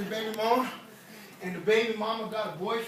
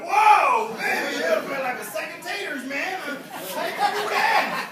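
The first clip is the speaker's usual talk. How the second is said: loud, high-pitched amazement; starstruck gushing; 8 semitones higher, 9 dB louder, slower than usual. Acted. Fan grabbing a bodybuilder's arm, starstruck and loud. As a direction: gush like an awestruck fan.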